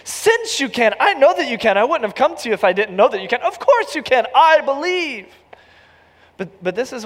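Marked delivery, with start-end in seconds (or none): 0.0-5.3 much higher, louder insistent protest with a laugh; eager correction. Pretend. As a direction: correct him fast, almost shouting. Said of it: "I'm gonna say".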